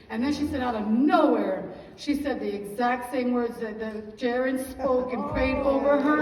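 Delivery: building to loud, preaching fervor; fervent testimony. Real, not acted. Woman testifying to a cheering congregation, fervent and building loud. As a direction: swell into a loud, testifying climax.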